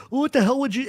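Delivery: high-pitched outraged squeal; mock a hurt, indignant victim; imitated Andy. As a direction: jump way up in protest. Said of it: "And Andy's like".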